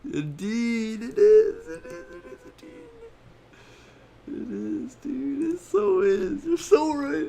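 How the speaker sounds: very high squeaky voice; squeaky mock agreement.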